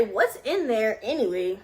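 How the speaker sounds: usual speaking voice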